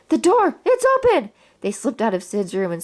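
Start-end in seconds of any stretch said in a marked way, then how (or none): 0.1-1.3 much higher, rising urgent yell; excited yell. Acted. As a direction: yell it out the moment the way is clear. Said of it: "Woody yelled".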